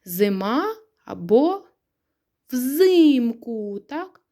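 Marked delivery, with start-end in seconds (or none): 2.5-3.8 взимку with a long, stressed ЗИ. Shown as a demonstration. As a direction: stretch the И in взимку. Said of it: "а цей перевіряється наголосом".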